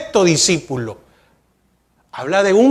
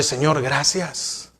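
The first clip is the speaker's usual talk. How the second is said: quiet thanks to God; 6 semitones lower, 8 dB quieter, slower than usual. Shown as a demonstration. Modelling a quiet prayer on the road.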